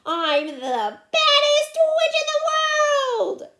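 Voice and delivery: high, loud witch cackle into a boast; gleeful yelling. Acted. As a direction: crow it slowly at full volume. Ramona carried away, boasting as witch.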